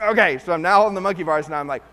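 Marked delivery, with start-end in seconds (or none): 0.0-0.4 forced okay. Retold or imitated. Reluctantly agreeing.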